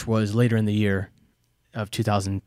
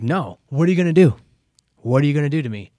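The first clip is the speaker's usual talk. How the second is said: defiant; 4 semitones higher, 4 dB louder, faster than usual. Retold or imitated. Drunk refusing to leave ambulance.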